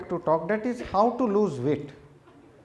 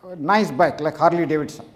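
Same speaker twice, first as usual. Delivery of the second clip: ordinary delivery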